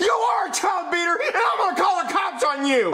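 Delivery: high accusing shouts; angry kid shouting. Acted. Child to father, angry high shouting. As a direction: yell the accusation at dad.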